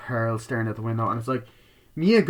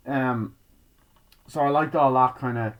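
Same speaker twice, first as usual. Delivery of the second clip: normal talking voice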